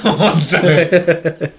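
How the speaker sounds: laughing through the words; amused laughing. Real, not acted. Laughing at co-host's accent.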